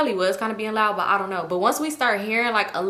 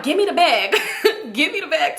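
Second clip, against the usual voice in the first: laughing; 6 semitones higher than usual. Retold or imitated. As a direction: say it through laughter. Laughing while voicing herself.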